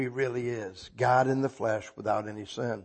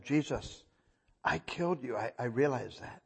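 soft confession; 7 dB quieter than usual. Retold or imitated. Repentant sinner's confession to Jesus.

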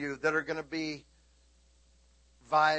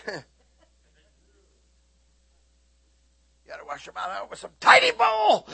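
mock shouted scolding; 2 semitones higher, 8 dB louder than usual. Preacher hamming up a shouted scolding at the congregation. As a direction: yell it like a fed-up mother. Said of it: "mock shout scolding".

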